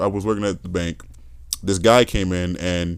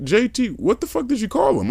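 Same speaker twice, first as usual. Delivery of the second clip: high-pitched imitation of a shocked manager; shocked demand; 14 semitones higher than usual. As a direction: demand an explanation, acting out the manager. Manager's shocked question acted out.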